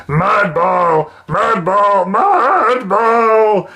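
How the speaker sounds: trolls' game chant getting wilder; troll shouts building. Acted. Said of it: "came shouts and laughter".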